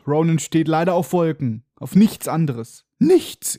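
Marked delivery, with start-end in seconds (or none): 3.0-3.6 mock insistence. Comic insistent repetition of word.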